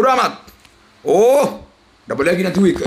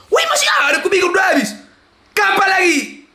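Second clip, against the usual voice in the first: surprised shout; 13 semitones higher than usual. Real, not acted. Streamer amazed the spins continue, surprised high shout. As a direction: yell it, amazed it isn't over. Bonus spins still running.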